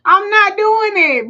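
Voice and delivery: laughing protest. Real, not acted. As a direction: burst out laughing while denying. Laughing denial.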